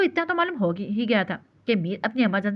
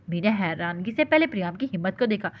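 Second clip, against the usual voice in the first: normal talking voice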